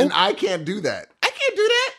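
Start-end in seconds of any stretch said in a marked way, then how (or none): 1.2-1.9 high, laughing protest; high laughing squeak. Real, not acted. To his cohost, a high laughing protest. Laughing after accent talk.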